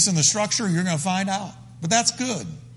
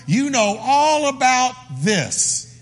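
slow, raised cry to God; impassioned prayer; 6 semitones higher, slower than usual. Acted. David telling the Lord what he knows, slow impassioned prayer. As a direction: draw out "all", high and pleading.

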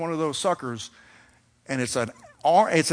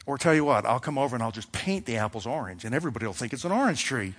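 ordinary talk, nothing marked

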